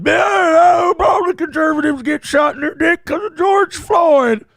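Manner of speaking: high, loud unhinged guest yelling; mock crazy shout. Crazy guest on Piers Morgan's show yelling nonsense. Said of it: "And then the guy goes".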